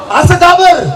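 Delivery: ordinary talk, nothing marked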